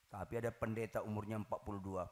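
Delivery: ordinary delivery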